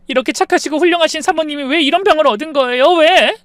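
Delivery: high, loud, rushing cry of grief; anguished outcry. Acted. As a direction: cry out why, breaking with pain. 아픈 사모님 앞에서 울부짖음. (높고 크게 울부짖는 비통한 외침)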